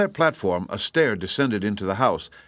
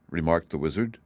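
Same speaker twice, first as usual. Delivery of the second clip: ordinary delivery, nothing marked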